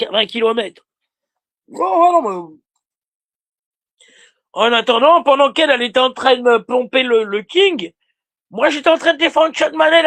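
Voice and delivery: usual speaking voice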